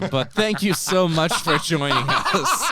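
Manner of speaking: loud, laughing sign-off; laughing goodbye. Real, not acted. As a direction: say goodbye while cracking up. Laughing outro to listeners.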